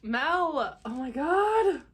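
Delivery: loud, high squeal of amazement; overjoyed cry. Real, not acted. Calling out to the friend who made the gift, overjoyed. Overwhelmed by friend's handmade book.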